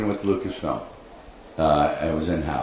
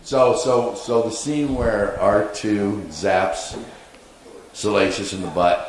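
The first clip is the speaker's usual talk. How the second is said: ordinary delivery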